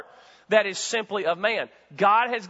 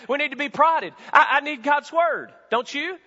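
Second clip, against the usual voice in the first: higher, louder impassioned preaching; preaching at full force; 9 semitones higher, 5 dB louder than usual. Acted. Preacher urging his flock, higher and impassioned. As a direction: drive it home to the church.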